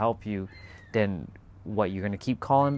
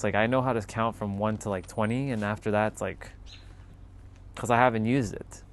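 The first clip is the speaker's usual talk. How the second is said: ordinary talk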